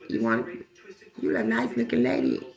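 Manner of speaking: a low, smooth pickup line; lightly voiced man. Man in car sweet-talking her, lightly low voice.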